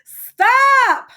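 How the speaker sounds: very high, loud shout; loud yell. Acted. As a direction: scream at him to stop. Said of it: "Miss Blagan yelled very loudly".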